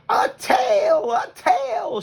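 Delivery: high, loud delighted cry; excited shout. Acted. Both possums cry out at the tail, high excited shout. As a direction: yell with joy at the new tail. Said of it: "shouted both possums at once".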